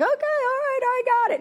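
squeaky, high-pitched nagged voice; whiny high self. Retold or imitated. Answering her nagging sponsor in a squeaky high voice. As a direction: pipe up high like a scolded kid. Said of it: "I was like".

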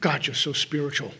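low, soft, reverent murmur of praise; saintly flatterer. Acted. Mother Teresa praising you at the kitchen table, saintly. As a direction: softly lay on the holy compliment. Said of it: "or Mother Teresa, telling you".

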